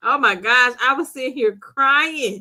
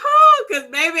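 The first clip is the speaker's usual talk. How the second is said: laughing; 5 semitones higher, 6 dB louder than usual. Real, not acted. Cracking up on stream.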